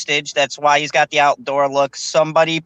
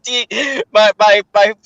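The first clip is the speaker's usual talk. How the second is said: normal talking voice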